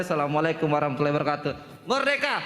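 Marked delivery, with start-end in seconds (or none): 1.9-2.3 high rousing shout of Merdeka; rally cry. Real, not acted. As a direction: call out to rally the room. Student leader's closing rally cry. (pekik semangat)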